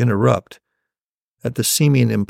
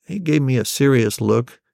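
plain reading voice

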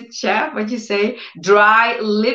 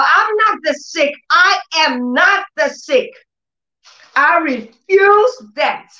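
preaching at full force; 7 semitones higher than usual. Real, not acted. Preacher recalling her pain, high tearful proclaiming. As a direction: proclaim healing through tears.

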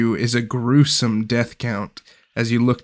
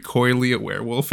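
normal talking voice

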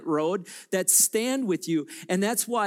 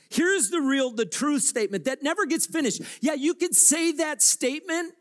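full-force preaching; 10 semitones higher than usual. Real, not acted. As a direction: preach it out over the whole room.

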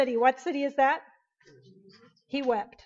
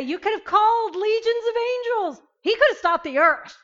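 high, insistent acted quote; exclaimed protest; 8 semitones higher, 5 dB louder than usual. Preacher voicing what they say of Jesus, high exclamation. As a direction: exclaim it high, getting louder.

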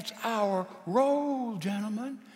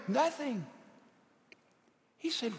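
usual speaking voice

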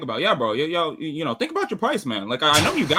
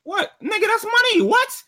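very high, loud disbelief; real incredulity; 6 dB louder, slower than usual. Real, not acted. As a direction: exclaim it high-pitched, astonished at the money. Caller astonished at money talk.

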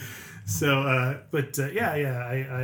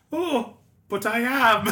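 mock Irish; 10 semitones higher, 7 dB louder, much slower than usual. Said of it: "Irish voice".

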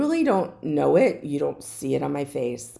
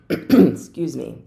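louder throat clear before a slow "Excuse me"; 8 dB louder, slower than usual. Real, not acted. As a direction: clear the throat, then apologize slowly. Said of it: "I have a cough, so you may hear me clear my throat".

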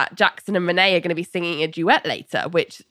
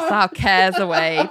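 ordinary delivery

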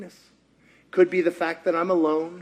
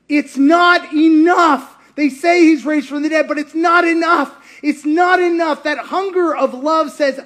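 insistent cry; 6 semitones higher, 5 dB louder than usual. Acted. Mary's hunger, preacher insists.

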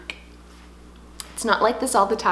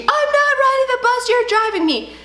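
put-on screaming kid; 13 semitones higher than usual. Son yelling across the street, high mock scream. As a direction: shriek it like a boy running off. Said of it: "He's screaming and crying".

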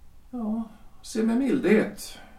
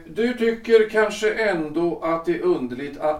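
usual speaking voice

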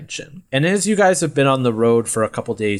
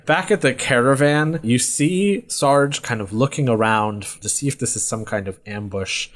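normal talking voice

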